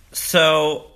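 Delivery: ordinary delivery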